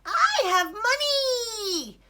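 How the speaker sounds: high, drawn-out duck call; exclaiming duck. Acted. Duck leads Ilyas to his hoard, excited high call. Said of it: "exclaimed Duck".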